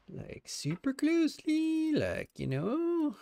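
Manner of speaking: put-on high, slow valley girl drawl. Shown as a demonstration. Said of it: "Sound like a valley girl right there".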